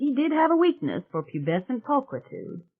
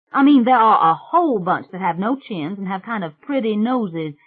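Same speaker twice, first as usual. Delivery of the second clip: put-on loud, high, brash country girl; a loud, high swamp witch bragging to the family; 5 semitones higher, 6 dB louder than usual. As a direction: belt it out like a cocky backwoods girl. [name]'s rowdy swamp girl voice.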